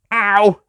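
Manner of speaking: sharp high yelp of pain. Acted. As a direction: yelp as if hit on the head. Master struck on head.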